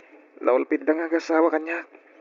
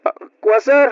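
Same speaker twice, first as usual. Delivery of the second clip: startled reply; 4 dB louder than usual. Acted. Startled boy answers stranger.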